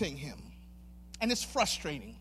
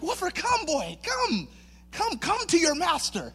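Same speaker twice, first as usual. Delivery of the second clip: imitating a hopeful master; coaxing dog call; 7 semitones higher than usual. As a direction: call the dog over in a higher voice. Acting out calling his puppy.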